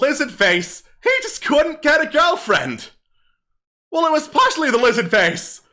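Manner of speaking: kobold comedian. Acted. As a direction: work the crowd like a hammy stand-up comic. Kobold tells joke.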